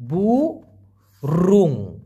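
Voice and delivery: বুরুং stretched very long, falling; drawn-out demo. Shown as a demonstration. Teacher quizzing viewers, answers with a long drawn বুরুং. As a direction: hold বুরুং long so they can copy it. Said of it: "কি বলা হয়?".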